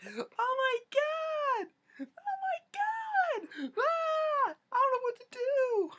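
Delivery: very high, stretched-out panicked screaming; girly screams. Retold or imitated. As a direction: shriek it like a terrified little girl, slow and drawn out. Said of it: "girly screams like".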